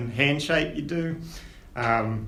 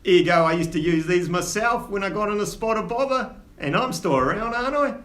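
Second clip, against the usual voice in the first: lightly voiced Cockney dwarf dad, scolding but fond; a hint of a gruff old dwarf, with a higher Cockney lilt; dwarf father in Cockney; 8 semitones higher, 3 dB louder than usual. Old dwarf father chiding his son, lightly Cockney. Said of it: "And he says".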